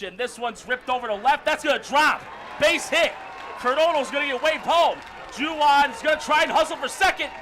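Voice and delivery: high, loud shouted baseball call; excited play-by-play. Real, not acted. Announcer calls a run-scoring double, excited shouting. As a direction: shout the runners round the bases.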